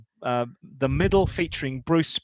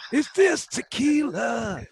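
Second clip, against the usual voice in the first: mock Halifax [name]; 9 semitones higher, slower than usual. Mock [name] asking, high voice.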